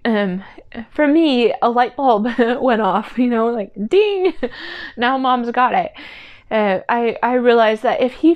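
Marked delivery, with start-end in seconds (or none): 3.9-4.3 high, bright bell-like ding; playful ding. Pretend. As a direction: ring it out like a bell. Imitating light bulb going off.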